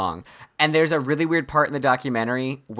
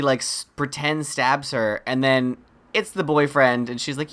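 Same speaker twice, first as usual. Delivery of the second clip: usual speaking voice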